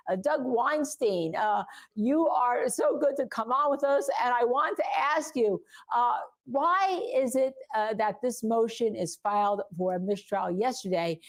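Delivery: ordinary talk, nothing marked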